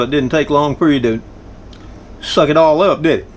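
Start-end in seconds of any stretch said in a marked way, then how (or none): none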